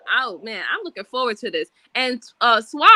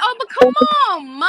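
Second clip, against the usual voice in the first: imitating a higher-pitched kid's plea; pleading son; 8 semitones higher, 4 dB louder, faster than usual. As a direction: wheedle Mom like a pleading child, pitched up. Mother voices her pleading son.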